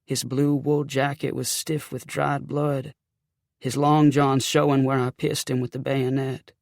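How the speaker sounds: usual speaking voice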